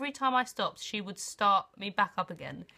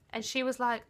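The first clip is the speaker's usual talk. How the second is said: usual speaking voice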